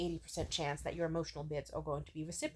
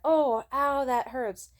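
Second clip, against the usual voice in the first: loud, higher acted yelp of pain; retold pain; 6 semitones higher, 8 dB louder, much slower than usual. Speaker retelling a cactus prick, acted pained cry. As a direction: re-enact a sudden "ow", pushed up and loud.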